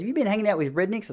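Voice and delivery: quick, high-pitched mock questioning from mum; imitating mum. Mum calling after seeing the photo, lightly imitated. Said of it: "My mum looked at that photo".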